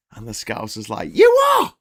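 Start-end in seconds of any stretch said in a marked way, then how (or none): 1.1-1.7 very high, loud outburst; shrieked Scouser indignation; outraged yell. Acted. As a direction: explode in acted outrage. Scouser's outraged reply to proposition.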